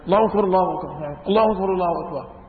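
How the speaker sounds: rushed, running Allahu akbar; hurried takbir. Retold or imitated. Latecomer rushing to join the group, gabbling fast. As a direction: gabble it while hurrying to catch the prayer. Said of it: "بيعمل".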